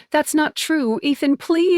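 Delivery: tearful begging. A narrator's reading. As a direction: beg him not to leave, voice near breaking. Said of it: "I'm begging now, tears streaming".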